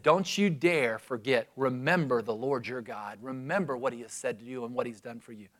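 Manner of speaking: softly, almost under the breath; hushed farewell charge. Retold or imitated. Moses, retiring, quietly charging the people not to forget.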